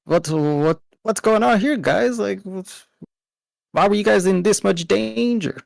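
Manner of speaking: put-on Southern accent pushed way up in pitch; bad southern accent. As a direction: try for a Southern accent and miss. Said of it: "tries to talk in a southern accent".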